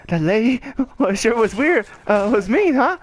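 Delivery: high, wobbly mock-tearful voice; about to cry. Roasted man confiding in his seatmate, mock near-tears. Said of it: "that voice like he was about to cry".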